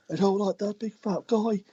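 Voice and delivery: high-pitched imitation of whining complainers; mock complaint. Wrestler imitates offended fans telling on him, high mock complaint. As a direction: mimic an aggrieved tattletale, pitched right up. Mimics complaining crowd members.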